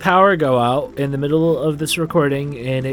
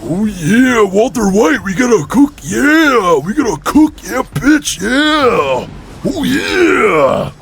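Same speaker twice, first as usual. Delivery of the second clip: high, straining wrestler growl; hammed-up, drawn-out wrestler impression; Macho Man Randy Savage; 7 semitones higher, 4 dB louder, slower than usual. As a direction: stretch each phrase like a manic wrestling promo. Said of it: "Jesse sounded like Macho Man Randy Savage".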